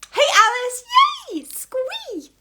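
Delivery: high shouted hey into a squeal; excited squeal. Real, not acted. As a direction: squeal with delight at a friend. Greeting viewer Alice excitedly.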